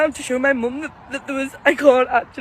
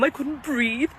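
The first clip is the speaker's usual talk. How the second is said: much softer, breaking into tears; the memory brings the tears back; tearful; 7 dB quieter, slower than usual. Real, not acted. Telling viewers about the robin, softly crying. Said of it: "crying to my mum".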